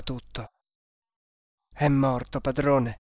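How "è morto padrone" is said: ordinary delivery, nothing marked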